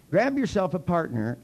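usual speaking voice

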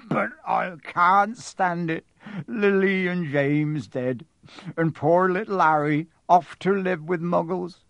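slow grieving plea; slow, through tears; tearful. A narrator's reading. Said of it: "sobbed Hagrid".

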